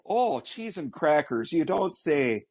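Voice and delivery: mock Minnesota. Co-host answers in Minnesota accent.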